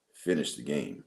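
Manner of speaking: imitating a legendary coach, deep and quiet; deep-voiced coach. Old coach to a young player in the backyard, deep-voiced. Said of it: "says in that deep voice".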